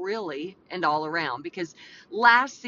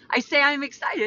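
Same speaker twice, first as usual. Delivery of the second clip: higher, perkier delivery; changed excited voice; 6 semitones higher, 3 dB louder than usual. Shown as a demonstration. Host telling husband she is excited, in raised voice. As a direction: lift it high to sound excited. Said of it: "why I changed my voices".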